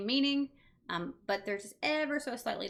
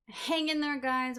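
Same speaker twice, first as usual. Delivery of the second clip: usual speaking voice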